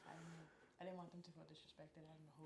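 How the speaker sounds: hushed, careful whisper. Real, not acted. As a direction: whisper so nobody feels insulted. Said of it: "Why are you whispering?".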